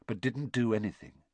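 plain reading voice

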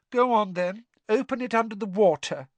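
put-on high, airy ghost girl; high girlish ghost voice; Myrtle's ghostly voice. A narrator's reading. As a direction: speak like a glum schoolgirl ghost. Myrtle bossing.

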